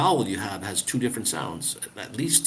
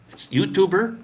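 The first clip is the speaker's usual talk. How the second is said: usual speaking voice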